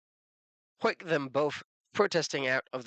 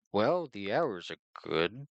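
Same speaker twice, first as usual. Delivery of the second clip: slow dim-witted voice; 3 semitones lower, slower than usual. Acted. Dim-witted Vogon answers slowly.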